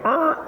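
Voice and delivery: short high-pitched dog yelp; dog noise. Retold or imitated. Storyteller mimics the dog stuck in the elevator, high yelp. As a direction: imitate the desperate dog with a high little noise. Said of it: "my dog is like".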